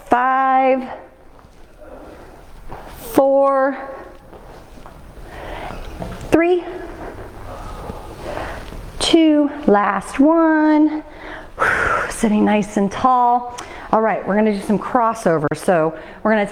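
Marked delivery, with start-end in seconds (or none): 0.1-11.0 rising countdown. Real, not acted. Coach counting down final reps.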